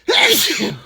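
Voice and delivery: loud real sneeze; big explosive sneeze; sneezing. Real, not acted. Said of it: "I gotta sneeze".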